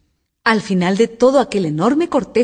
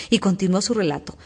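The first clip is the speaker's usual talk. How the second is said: ordinary delivery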